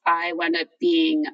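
ordinary talk

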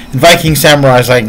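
ordinary talk

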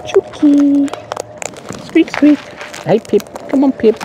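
high sing-song chook call; calling chickens. Real, not acted. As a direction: coax the hens in a high voice. Calling the birds.

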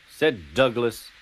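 ordinary delivery, nothing marked

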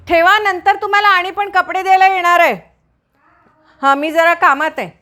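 loud high call from another room; busy woman calling out loudly. Real, not acted. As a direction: raise your voice so it carries to the door. Calling out to maid at door.

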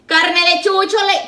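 loud, high street-seller call; mock vendor cry. Vendor joking to customers about the meat, loud hawker's cry. Hawking food as a joke.

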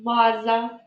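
"Ваза" with the opening В held; teacher sounding out В in "Ваза"; stressed first sound. Shown as a demonstration. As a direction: linger on the v of "Ваза". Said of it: "Визначимо перший звук".

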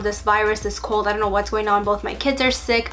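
normal talking voice

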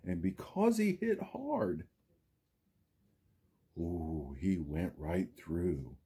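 lively storybook read, big rises and falls; reading to children. A narrator's reading. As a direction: read it like story time for little kids. Teacher reads Seuss book aloud.

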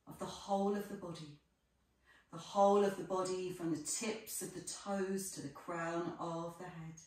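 ordinary talk

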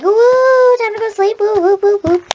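happy woo. Retold or imitated. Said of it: "I was like".

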